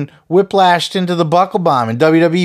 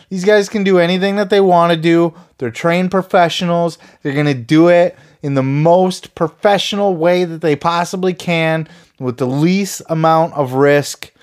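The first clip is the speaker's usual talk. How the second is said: usual speaking voice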